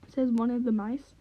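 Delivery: ordinary delivery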